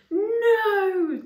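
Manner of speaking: high piercing scream of no. Acted. Chaya at the burning door, screaming. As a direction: shriek it in panic. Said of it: "Chaya screamed".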